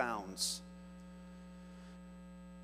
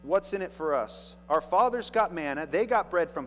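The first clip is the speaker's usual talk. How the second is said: pressed, louder demand; louder demanding crowd; 5 semitones higher, 8 dB louder than usual. Retold or imitated. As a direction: push the complaint out louder. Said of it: "they say".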